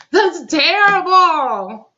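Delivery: slow, high, drawn-out cry; anguished wail. Real, not acted. Host reacting to how minorities are treated, anguished wail. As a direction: wail it out, stretching every word. Host wails over the injustice.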